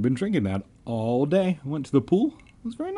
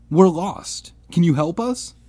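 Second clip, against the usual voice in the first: high, pleading appeal; urgent appeal; 7 semitones higher, 4 dB louder than usual. A narrator's reading. Lost narrator asks strange kids for help, urgent appeal.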